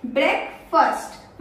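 "ब्रेकफास्ट" split as brek, fust; slow BREK-fust for "ब्रेकफास्ट"; correct pronunciation. Shown as a demonstration. Teacher answering her own question, correct pronunciation. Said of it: "क्या बोलते हैं".

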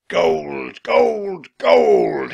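loud pirate bellow leaping in pitch; ecstatic, growling villain cry; crying out greedily. A narrator's reading. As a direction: crow over treasure like a pirate captain. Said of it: "he cried".

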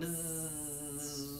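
low buzzing hum; bee buzz. Shown as a demonstration. Teacher buzzing like a bee for kids.

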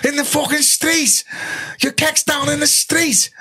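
very high, slow disbelieving cry; incredulous shock. Retold or imitated. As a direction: squeal it out in total disbelief. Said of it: "And I went".

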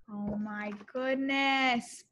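normal talking voice

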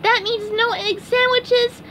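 very high cartoon wail; SpongeBob crying. A narrator's reading. SpongeBob tells Gary the eggs are gone, mock crying. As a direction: lament it high, starting loud. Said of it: "SpongeBob went home and cried".

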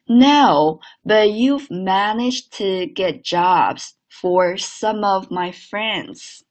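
slow, linked model sentence for repeating; read-along model. Shown as a demonstration. Teacher leading students in unison, slow linked reading. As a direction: take it slowly, keeping "some of" joined. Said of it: "来，来，一起来一遍啊".

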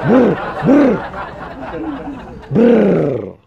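rolling brr noise; trilled "brr". Real, not acted. Vlogger cheering his idol, trilled brr. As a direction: trill out short bursts.

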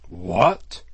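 loud, outraged burst of disbelief; greatly scandalized. A narrator's reading. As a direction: exclaim as if deeply shocked. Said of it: "greatly scandalized".

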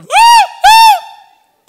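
very high, loud dog howl; imitated dog noise. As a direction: yelp "woo" like a noisy dog. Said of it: "Dog will make noise".

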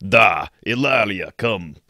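lightly put-on, bad Russian accent to the cat, slow; mock Russian accent, hammed-up, calling the cat. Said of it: "really bad Russian accent".

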